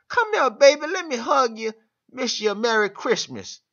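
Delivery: put-on high, sweet old grandma cooing. Vlogger voicing grandma greeting grandkid at Christmas, high cooing.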